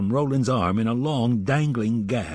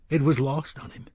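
plain reading voice

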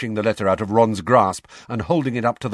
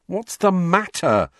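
drawn-out, higher-pitched impatience; impatient; 9 semitones higher, 4 dB louder than usual. A narrator's reading. Fred demanding to know what is up with Ron, impatient. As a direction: impatiently press for an answer. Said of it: "said Fred impatiently".

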